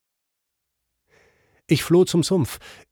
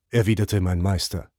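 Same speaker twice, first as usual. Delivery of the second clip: plain reading voice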